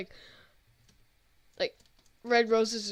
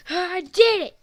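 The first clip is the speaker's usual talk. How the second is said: loud slow victory shout; triumphant shout; 6 semitones higher, 10 dB louder, slower than usual. Real, not acted. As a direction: shout out the win. Triumph after game level.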